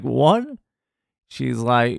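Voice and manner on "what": high-pitched, startled question; a taken-aback one-word question, rising in pitch; surprised retort. Retold or imitated. Applicant hears he has been denied entry, taken aback.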